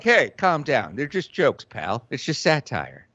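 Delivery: low, offhand brush-off; dropped pitch, casual dismissal; dismissive mock voice. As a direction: play a low-voiced guy waving off complaints. Voices America dismissing offense.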